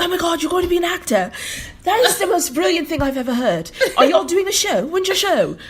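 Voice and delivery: high, fast, bubbly mock admirer; gushing European fan. Imitating Europeans impressed by theater students, gushing high. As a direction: gush up high like a thrilled stranger. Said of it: "It's like".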